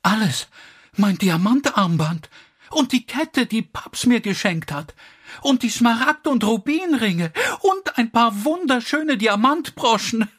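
high-pitched, mounting lament; distraught cry. A narrator's reading. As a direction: wail the list of losses, far above normal pitch. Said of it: "rief Elsie".

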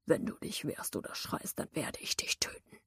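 deep rasping whispered growl; menacing hoarse whisper; growled threat. Acted. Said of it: "knurrte eine Stimme".

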